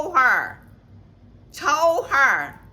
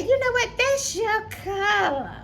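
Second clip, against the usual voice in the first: mock sweet compliment; 9 semitones higher than usual. Woman complimenting her outfit, high and sugary. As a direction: coo it like a fake-nice lady.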